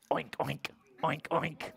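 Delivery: short, low pig oinks. Acted. Puppy George answering his mother, pig oinks. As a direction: oink like a pig in a children's story. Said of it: "And George said".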